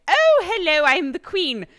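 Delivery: loud, high caricature English queen; hammed-up British. Shown as a demonstration. Streamer showing her exaggerated English accent, hammed-up British queen. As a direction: lean hard into an over-the-top British accent. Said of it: "if I lean into the, like".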